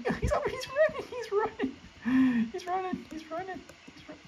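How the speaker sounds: laughing in a very high voice. Real, not acted. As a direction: giggle it out high as he chases.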